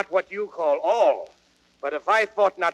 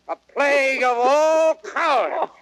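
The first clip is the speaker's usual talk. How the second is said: furious rant; 6 semitones higher, 4 dB louder than usual. Acted. Falstaff rants about cowards.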